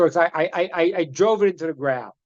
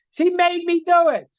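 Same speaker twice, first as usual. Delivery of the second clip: put-on whiny kid; 10 semitones higher than usual. Child excuse.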